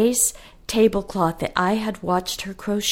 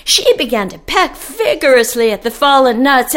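usual speaking voice

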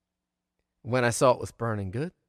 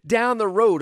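ordinary delivery, nothing marked

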